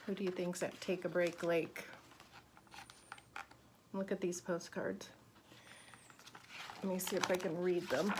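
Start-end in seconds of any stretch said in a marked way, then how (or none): none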